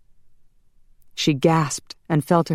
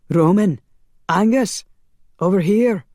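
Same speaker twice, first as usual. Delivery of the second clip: louder, raised-pitch summons; urgent calling out; 5 semitones higher, 5 dB louder than usual. Acted. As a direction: call your comrades over. Calling comrades over for help.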